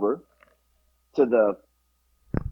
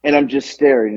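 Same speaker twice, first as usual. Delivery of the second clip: ordinary talk, nothing marked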